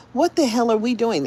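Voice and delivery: ordinary talk, nothing marked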